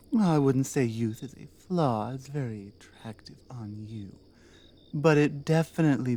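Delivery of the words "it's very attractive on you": intimate whispered compliment. Acted. Orc captor flirting with the human prisoner, whispered. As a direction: whisper it close to the ear.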